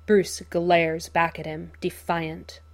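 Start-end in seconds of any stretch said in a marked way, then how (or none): none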